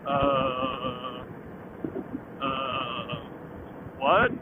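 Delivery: low, soft drawn-out uhs, then what; retold slow-witted disbelief; mock dumbfounded. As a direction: act stunned, stalling in a low murmur. Stunned at wife's license demand.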